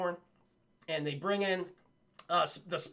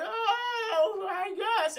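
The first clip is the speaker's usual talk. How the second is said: put-on high, whiny old lady voice; reluctant granny, pitched way up; old Aunt May; 12 semitones higher, much slower than usual. Aunt May grudgingly letting Felicia take Peter, high and whiny. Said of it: "She's like".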